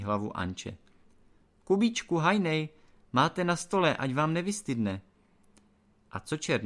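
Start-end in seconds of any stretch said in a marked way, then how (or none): 1.6-5.0 high, loud call through the door; woman calling. A narrator's reading. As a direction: call them to the table. Anče calls the men to eat.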